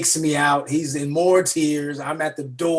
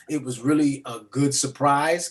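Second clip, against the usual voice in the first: ordinary talk, nothing marked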